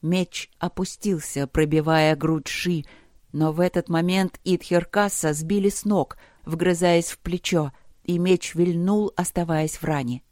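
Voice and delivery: ordinary delivery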